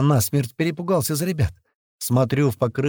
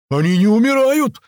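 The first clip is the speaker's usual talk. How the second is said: high, loud cry of alarm; yelling into radio; 11 semitones higher, 6 dB louder than usual. A narrator's reading. Корж reporting over the radio, high loud yell. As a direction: scream the alarm over the gunfire. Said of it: "заорал в рацию Корж".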